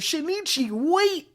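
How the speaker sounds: high-pitched acted plea; pleading call. Ron reaches out as Shinichi leaves, pleading call. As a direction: call after someone leaving, pitched well up.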